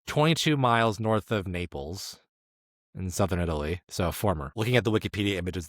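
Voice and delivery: ordinary talk, nothing marked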